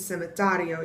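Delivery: cementario with the stress on "ta"; stress on accented syllable. Shown as a demonstration. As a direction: lean on the TA of cementario. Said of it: "putting the emphasis on the syllable".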